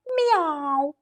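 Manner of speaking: drawn-out imitated cat meow. Cat ends its request, long meow.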